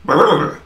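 loud mock rolled brrr; imitated growl roll. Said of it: "He's like".

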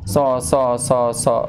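so said without raising the tongue root; wrong so demo. Shown as a demonstration. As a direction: imitate a learner's flat so. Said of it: "pangkal lidah nggak naik sama sekali".

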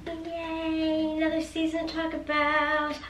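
high bubbly cheerleader cheer; chirpy, peppy yay. Real, not acted. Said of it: "talking like a cheerleader".

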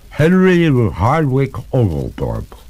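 a hint of a slow, gurgling water ghost; lightly voiced ghost, slow and gurgling. Water ghost scolding the master, lightly gurgling. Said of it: "said the ghost in a gurgling voice".

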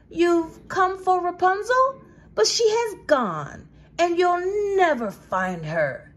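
high, loud, cruel gloating taunt; angry witch. Acted. Witch taunting the prince at the tower top, high and cruel. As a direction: crush his hope with every word. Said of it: "Still angry".

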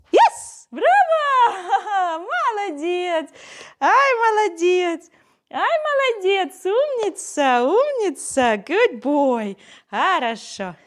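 high, loud gushing praise; excited praise. Real, not acted. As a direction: cheer the pup on. Praising dog after success.

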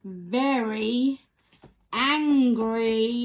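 very angry, angry punched loud; stress on angry. A narrator's reading. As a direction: lean hard into the word angry. Reading the climax aloud.

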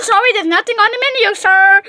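high, loud shouted apology; exasperated shouting. Pretend. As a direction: yell it at a hopeless customer. Worker loses patience with customer.

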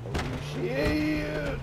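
high, loud, drawn-out yelp; long high-pitched cry of alarm; alarmed exclamation. Real, not acted. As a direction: stretch the swear out on a high held note. Sudden trouble in the game.

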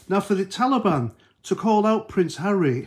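ordinary talk, nothing marked

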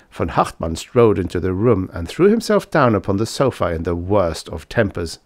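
ordinary delivery

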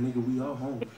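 low, soft voice of a hungry stomach; growling stomach voice. Acted. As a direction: speak as his own grumbling belly. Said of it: "Stomach started saying".

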